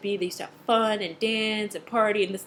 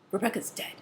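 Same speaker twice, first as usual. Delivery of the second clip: morbid; 7 dB quieter than usual. Retold or imitated. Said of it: "she's all morbid about it".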